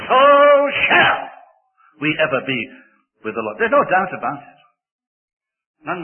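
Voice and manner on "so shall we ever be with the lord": SHALL set apart, very high and loud; stress on SHALL. Shown as a demonstration. Preacher closes the reread passage, stress on SHALL. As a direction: cry out "shall" between pauses, then settle down. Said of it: "emphasizing each word".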